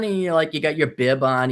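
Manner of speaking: ordinary talk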